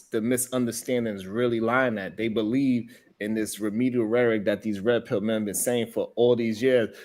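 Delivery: usual speaking voice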